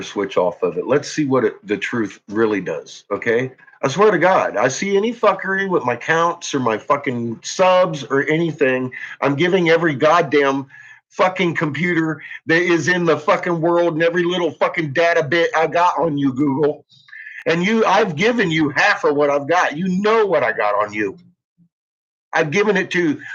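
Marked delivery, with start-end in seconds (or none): 3.8-16.9 raised, heated threat with swearing; furious threat. Real, not acted. Angry threat at Google. 17.5-23.2 raised, heated threat with swearing; furious threat. Real, not acted. Angry threat at Google.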